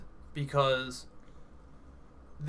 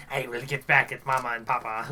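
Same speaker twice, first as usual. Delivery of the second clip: put-on sinister kids voice; 4 dB louder than usual. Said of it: "they're just like".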